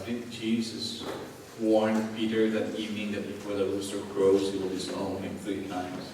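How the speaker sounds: hushed, low dramatic telling; solemn storytelling. Acted. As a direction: tell it low and soft, with long pauses. Hushed telling of rooster crowing.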